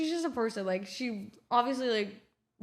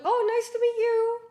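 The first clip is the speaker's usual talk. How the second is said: high, drawn-out fake-casual greeting; put-on nonchalance; 11 semitones higher, 5 dB louder than usual. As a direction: overdo the casual act, voice pitched way up. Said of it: "I gotta act nonchalant too".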